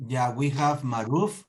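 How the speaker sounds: ordinary talk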